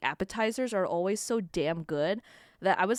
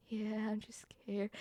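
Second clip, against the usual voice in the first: very soft, slow, sheepish admission; small scared voice; 12 dB quieter, slower than usual. Retold or imitated. Answering the kind man next to them, small scared voice. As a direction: shrink it down to a meek murmur. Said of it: "when I'm scared".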